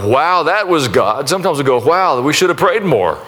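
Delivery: ordinary delivery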